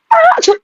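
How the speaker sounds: high squealing laugh breaking out; burst of laughter. Real, not acted. Reader cracking up at her own plot, squealing laugh. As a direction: crack up at the love triangle.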